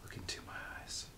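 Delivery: fast, urgent whisper. Acted. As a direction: hush it like a secret. Haunted confession to a visitor.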